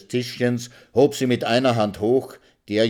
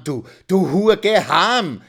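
high, loud forced-out curse; blurted in anger; 11 semitones higher, 8 dB louder, slower than usual. Acted. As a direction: spit it out bluntly. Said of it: "stieß er stumpf heraus".

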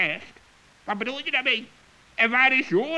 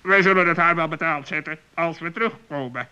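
lower, grim threat; low grim vow; 8 semitones lower than usual. Acted. Raven vows to the friends to pay the witch back, low and grim. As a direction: promise payback darkly.

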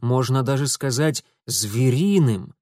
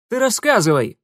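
much higher, louder shout; loud sharp command; barked order; 9 semitones higher, 6 dB louder than usual. A narrator's reading. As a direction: bark at the captive. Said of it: "прикрикнул Урусов".

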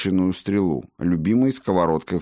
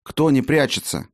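higher-pitched, imploring question; pleading; 8 semitones higher than usual. A narrator's reading. Healer begging to know who is not hiding, pleading. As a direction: press for an answer, pleading. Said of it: "взмолился Фиделис".

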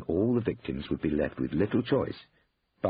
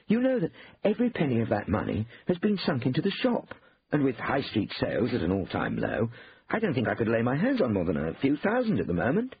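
higher-pitched protesting brother; Hugh, defensive; 7 semitones higher than usual. A narrator's reading. Brother pleads he has no money, defensive protest. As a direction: make excuses in a raised pitch.

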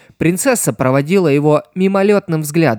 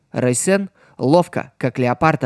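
usual speaking voice

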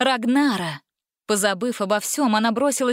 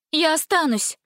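higher, resolute declaration; firm; 7 semitones higher than usual. A narrator's reading. Bride declares she will stay, firm. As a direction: state it firmly, pitch lifted. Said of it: "твёрдо сказала".

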